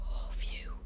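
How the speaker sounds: whispered sigh of relief; relieved. Pretend. Relieved that Slush beat the ghost, breathed out. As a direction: let it out softly on a breath.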